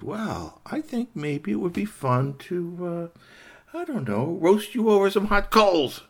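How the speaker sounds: slow, high musing building to loud relish; gloating fox. Acted. Fox threatening to roast rabbit, teasing then gleeful. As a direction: draw it out, then burst out loud.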